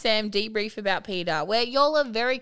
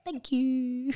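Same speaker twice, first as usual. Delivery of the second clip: drawn-out mock LA delivery; LA accent attempt; 3 semitones higher than usual. Shown as a demonstration. Co-host clowning with a thank you, mock LA. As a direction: put on a slight LA accent. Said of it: "I went for more vocal fry".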